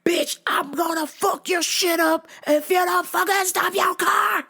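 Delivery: high, furious yell out of the car window; angry shout. Retold or imitated. Vlogger hanging out of his car, yelling at the driver. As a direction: threaten her at the top of the voice. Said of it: "flailing the fist".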